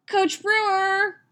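high, drawn-out squeal; clamouring girl. A narrator's reading. Girl in a clamouring group calls to coach, squealing. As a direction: call out long and high for coach. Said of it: "screaming, bouncing girls".

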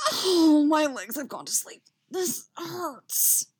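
high, loud groaning complaint; hammed-up wail of discomfort; pained whine. A narrator's reading. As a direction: moan theatrically about aching legs. Character complains of numb legs.

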